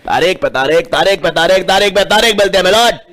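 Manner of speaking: mock dramatic Bollywood courtroom line, rising; lightly hammed filmy hero; filmy courtroom dialogue. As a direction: ham up the famous film dialogue. Famous film dialogue parody.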